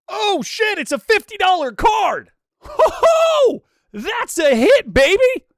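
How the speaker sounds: very high, loud whooping; real excited shouting. Real, not acted. Streamer learns it's a fifty dollar card, elated shouting. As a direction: shout it like you hit the jackpot.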